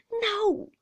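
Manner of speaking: high, forceful cry; startled, emphatic shout; loud outcry. A narrator's reading. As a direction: blurt it out at full voice. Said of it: "with an astonishing volume of voice".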